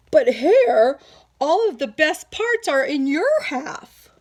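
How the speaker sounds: loud, gruff-voiced protest; complaining bear. Bear protests to Hare about his share, loud. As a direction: object loudly like a cheated bear.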